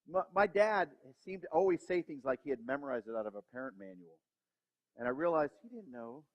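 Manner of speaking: ordinary talk, nothing marked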